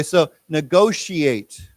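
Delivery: negotiate said slowly, syllable by syllable; slow model word. Shown as a demonstration. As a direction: spell out negotiate slowly for the class. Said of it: "sh sound".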